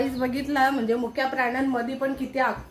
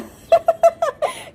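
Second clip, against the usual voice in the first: squealing laugh; 10 dB louder than usual. Real, not acted. Spotted by the puppy.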